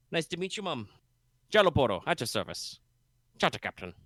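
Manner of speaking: a light Jamaican-bayou lilt, easygoing; vaguely Jamaican bayou accent. Acted. Boat captain greets the party, lightly Jamaican bayou accent. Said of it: "my vaguely Jamaican bayou accent".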